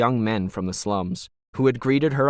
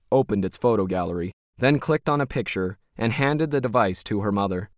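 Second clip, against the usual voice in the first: ordinary delivery, nothing marked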